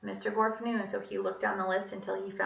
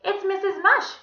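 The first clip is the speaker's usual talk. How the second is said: high, loud lady's singsong; cheery Miss Mush; 12 semitones higher, 11 dB louder than usual. A narrator's reading. Visitor calling through the classroom door, cheery high voice.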